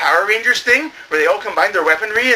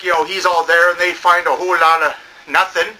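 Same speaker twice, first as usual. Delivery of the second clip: ordinary delivery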